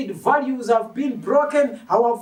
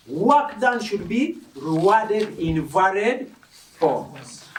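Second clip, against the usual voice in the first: ordinary delivery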